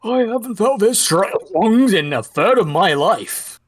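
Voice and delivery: put-on triumphant, strong old man; proud and reinvigorated. Player voices reinvigorated old man.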